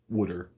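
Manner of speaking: wooder the South Jersey way. Shown as a demonstration. To the viewers, South Jersey saying wooder. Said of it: "Or South Jersey".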